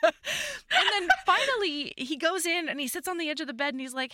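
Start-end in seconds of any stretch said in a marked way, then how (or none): 0.0-1.9 higher, slow, still laughing; real laughter fading into narration. Real, not acted. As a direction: laugh while picking the story back up. Laughing while resuming story.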